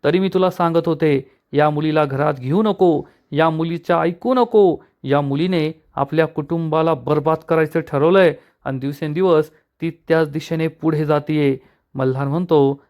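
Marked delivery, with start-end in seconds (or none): none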